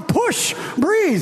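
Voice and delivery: imitating the doctor yelling push and breathe; mock doctor yell. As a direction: bark it like a doctor in the delivery room. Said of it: "the doctor was yelling at the pregnant woman".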